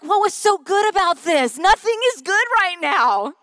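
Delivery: high, loud exasperation; retold mad outburst. Mom snapping at cheerful daughter at the bakery, mad. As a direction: fire it back, high and indignant. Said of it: "And I leave mad".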